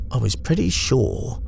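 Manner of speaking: ordinary delivery, nothing marked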